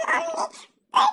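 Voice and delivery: ordinary talk, nothing marked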